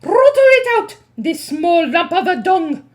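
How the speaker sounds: high loud witch screech. Acted. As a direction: screech with fury. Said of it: "screeched the Grand High Witch".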